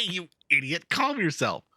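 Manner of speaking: ordinary talk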